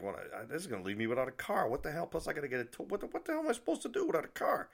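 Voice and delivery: retold exasperated rant; exasperated dismay. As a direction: pitch climbing into a squeaky protest. Retelling his dismay at losing car.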